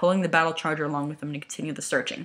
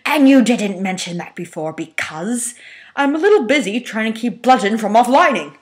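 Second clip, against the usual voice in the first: louder, higher exasperated snap; irritated retort; 5 semitones higher, 8 dB louder than usual. Acted. Hook snapping at Megatron while working. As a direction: throw it back at him, overworked.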